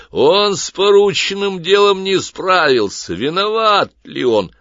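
loud, high, detached pronouncement; impassive judge voice. A narrator's reading. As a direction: announce it like an unfeeling judge. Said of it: "первый бесстрастный голос". (бесстрастный голос судьи)

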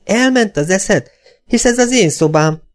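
high-pitched protesting mother; affronted, high complaint; indignant mother. A narrator's reading. Mother objects, voiced in story.

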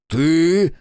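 startled high "Ты?"; surprised. A narrator's reading. Fugitive startled at the window, surprised. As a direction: caught off guard, blurt it out. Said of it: "удивлённо произнёс".

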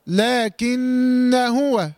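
لكن هو said "laakinna huwa", held n, short a before huwa; joined recitation. Shown as a demonstration. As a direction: run لكن into هو with the alif dropped, slowly chanted. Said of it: "ها، سقط وصلا".

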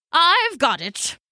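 high, stretched eureka; excited, drawn-out realisation; sudden inspiration. A narrator's reading. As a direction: announce the idea with a slow swoop. Poppo suddenly has an idea.

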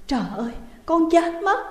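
high, sobbing lament; sobbing cry. A narrator's reading. Daughter fallen to the ground, sobbing. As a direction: wail from the floor. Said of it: "khóc nức nở".

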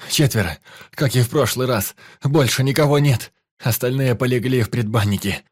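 high, loud pained groaning. A narrator's reading. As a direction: force it out through the pain. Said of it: "простонал раненый".

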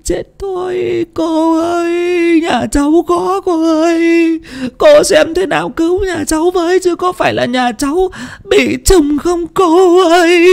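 terrified woman begging the fortune teller to save her family; pleading near tears. A narrator's reading. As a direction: beg desperately as if about to cry. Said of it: "nói như sắp khóc".

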